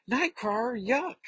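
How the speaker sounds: high-pitched squeal of disgust; disgusted Kitty. Acted. Kitty's disgusted reaction in comic.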